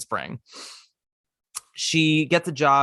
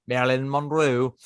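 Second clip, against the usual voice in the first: put-on Liverpool accent. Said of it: "That's a terrible Liverpoolian".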